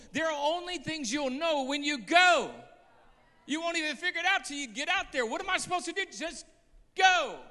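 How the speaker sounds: loud, high preacher cry; preaching at full force. Acted. Preacher urging the church to go out, shouting. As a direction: thunder it to the congregation.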